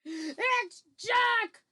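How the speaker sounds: high, loud bellow; terrified bellow. Acted. Giant dreams that Jack has come, bellowing. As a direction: bellow the name in dread. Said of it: "bellowed the flesh lump eater".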